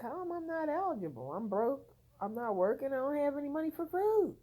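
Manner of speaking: high, fast, incredulous protest; indignant disbelief. Retold or imitated. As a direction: pitch it up in disbelief, how can this be. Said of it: "And I was like".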